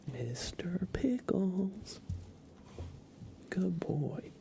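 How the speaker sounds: ordinary delivery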